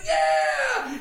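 put-on high, drawn-out cheer as the kid; imitated excited yell. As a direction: echo the coach's cheer like a thrilled young player. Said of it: "And he's like".

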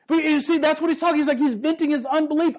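normal talking voice

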